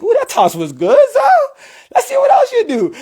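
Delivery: high-pitched, delighted self-commentary; thrilled, voice shooting way up; excited inner commentary. Retold or imitated. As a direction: squeal with surprise at how well it's going. Watching own routine, thrilled inner voice.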